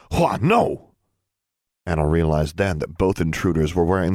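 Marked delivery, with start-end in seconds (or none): 0.1-0.9 high, sharp fatherly bark; barked warning. A narrator's reading. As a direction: bark it out to stop a child. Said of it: "her father barked".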